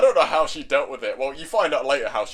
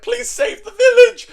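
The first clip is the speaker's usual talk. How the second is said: very high, loud, drawn-out wail; hammed-up squeaky begging; desperate pleading; 9 dB louder than usual. Pig boy begs Demon Lord, desperately pleading.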